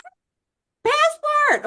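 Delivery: high, loud retold shriek; shrieked panic. Friend reacting to a sudden Spain trip invitation, shrieked panic. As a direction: squeal it out in mock alarm.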